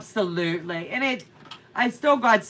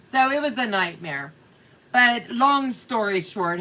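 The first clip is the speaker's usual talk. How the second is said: ordinary delivery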